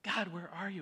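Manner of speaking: retold pleading prayer. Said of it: "it's just like".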